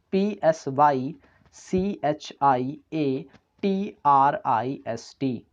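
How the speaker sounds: letters spaced out slowly; slow spelling. Shown as a demonstration. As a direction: dictate the letters one by one. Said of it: "spelling a word aloud". (धीरे-धीरे spelling)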